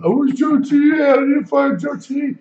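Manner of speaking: high, loud wail, hammed-up; mock crying. Friend tells how Country wigged out on mushrooms, mock crying wail. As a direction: imitate someone crying out in a panic. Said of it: "Starts, like, crying".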